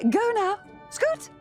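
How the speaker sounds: high dismissive shoo. Acted. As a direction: brush him off briskly. Shooing him away.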